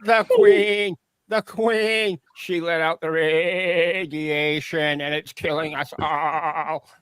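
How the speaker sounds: hammed-up frantic creature raving; frantic warning. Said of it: "And I say".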